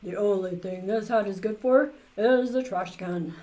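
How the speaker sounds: loud, scornful magician voice; scornful loud magician grumble; grumpy magician. A narrator's reading. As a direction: dismiss the hat with disgust. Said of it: "Professor Hinkle says".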